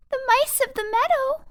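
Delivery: small voices at the door; timid mice. Acted. Mice answering Tweez's knock, high and timid.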